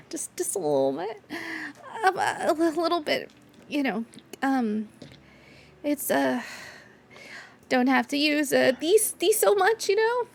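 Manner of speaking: high, halting and bashful; shy Gouda. Acted. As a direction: stumble shyly over the words. GM voices Gouda.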